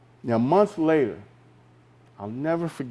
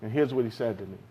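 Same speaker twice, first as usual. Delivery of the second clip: ordinary talk, nothing marked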